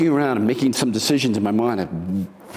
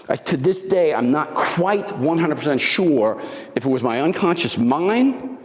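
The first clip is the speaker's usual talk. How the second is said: ordinary delivery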